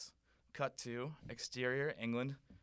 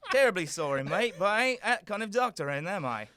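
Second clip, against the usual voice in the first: higher, louder cockney banter; cockney Doctor; 9 semitones higher, 9 dB louder than usual. Acted. The Doctor turning the prince down, in loud cockney. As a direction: play a brash cockney doctor.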